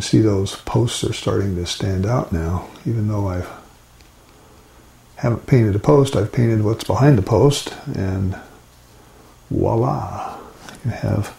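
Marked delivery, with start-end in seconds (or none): none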